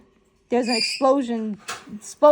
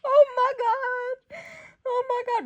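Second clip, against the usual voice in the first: high, slow amazed "oh my God"; real amazement, drawn out; excited cry; 13 semitones higher, slower than usual. Real, not acted. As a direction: stretch it out in shock. Still reacting to twist.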